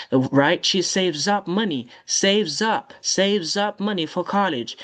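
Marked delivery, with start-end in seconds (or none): none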